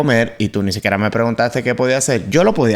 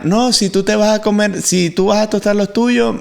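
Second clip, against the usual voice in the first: quoting his wife; 9 semitones higher than usual. Retold or imitated. Husband quotes his wife.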